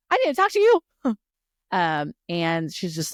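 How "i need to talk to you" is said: much higher and louder, breathless demand; urgent squeal. Retold or imitated. Violet cornering Xaden, high urgent and loud. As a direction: grab him and insist right now.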